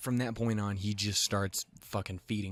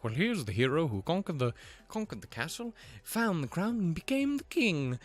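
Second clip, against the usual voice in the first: high, grand storybook hero tribute; lightly hammed-up bard voice; mock heroic announcer; 7 semitones higher than usual. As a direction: ham up a legend's praise. Mocking epic hero tale quote.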